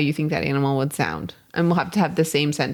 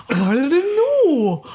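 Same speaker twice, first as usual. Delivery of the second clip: slow, much higher and louder Gandalf-like voice; octopus wizard voice; 5 semitones higher, 4 dB louder, much slower than usual. Acted. Friend redoes the octopus line sounding like Gandalf, slow. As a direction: drag it out like an ancient sea wizard. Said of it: "It was very Gandalf".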